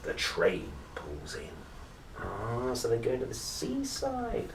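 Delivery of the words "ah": plain reading voice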